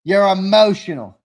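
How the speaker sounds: loud, mocking shout of you're emotional; teasing shout. Real, not acted. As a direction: yell it at him to needle him.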